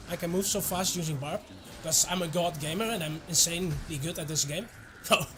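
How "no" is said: usual speaking voice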